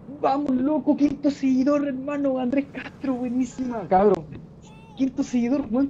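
high-pitched excited hype shout; hyped-up streamer celebration, voice way up; euphoric welcome. Shown as a demonstration. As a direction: cheer a new follower with euphoria. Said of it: "tenés que recibirlo así con euforia".

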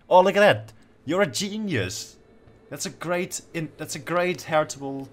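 high, loud burst of delight; thrilled praise. Real, not acted. Player marvels at the daughter's inherited trait, thrilled. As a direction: exclaim high, stretching out the praise.

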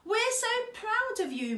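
high, loud, delighted praise; proud praise. A narrator's reading. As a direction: beam with parental pride. Mum praises Otto.

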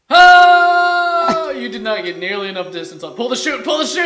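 loud excited yelling. Real, not acted. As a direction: shout in alarm at the fall. Teammate falling off mountain.

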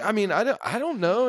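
high, halting imitation of a girl; flustered cashier. Teenage cashier replying to a yelling customer, flustered. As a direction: squirm through an unsure answer.

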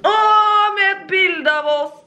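high, loud 'aww' of delight; touched and delighted. Real, not acted. Birthday girl finds a photo of the couple, high and touched. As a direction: melt with delight, voice jumping up.

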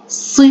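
ص as a short emphatic "si". Shown as a demonstration. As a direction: say ص with a quick kasra, "si". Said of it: "حركتها الكسرة، ألفظها".

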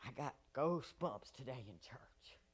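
soft hushed whisper. Real, not acted. Preacher confiding to the church, whispering. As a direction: drop to a breathy secret. Hushed testimony after the shout.

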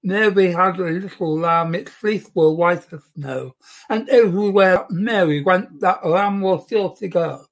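speaking with the tongue stuck out; tongue sticking out. Shown as a demonstration. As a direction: recite it with your tongue out. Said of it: "sounds a bit thick, doesn't it".

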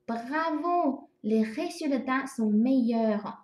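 slow, clear French example; teacher's slow model sentence. Shown as a demonstration. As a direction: read the example slowly for the class. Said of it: "比如说".

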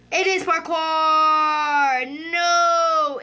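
very slow, loud stretched wail; drawn-out wail. Real, not acted. Kid admitting the game is parkour, wailing slowly. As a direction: stretch out the words in despair. Gives in, wails.

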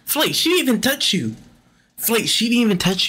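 high, disbelieving cry at the screen; can't believe she never touched him; incredulous. Real, not acted. Calling out to Flight in disbelief, high and incredulous. Yelling at player missing call.

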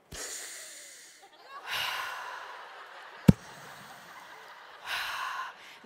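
long high airy breath demo; big breath in. Shown as a demonstration. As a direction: demonstrate a huge inhale. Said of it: "oxygen mask breathing demo".

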